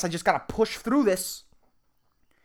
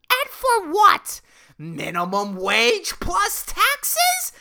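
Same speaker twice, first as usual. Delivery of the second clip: much higher, louder, very slow mocking wail; evil voice jeering; 3 dB louder, much slower than usual. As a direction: howl with scornful glee at the fool. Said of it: "This fucking voice of evil".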